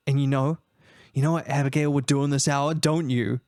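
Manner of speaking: low, measured dialogue voice; subdued wife. Acted. Spouse discusses Abigail sneaking out, low and restrained. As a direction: reason with them in a low voice.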